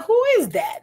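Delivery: jumps high with a startled "who is that"; high surprised question. Retold or imitated. Partner spotting the other man on the live, high startled. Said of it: "they're like".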